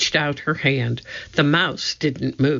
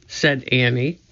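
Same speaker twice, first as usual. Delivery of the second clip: plain reading voice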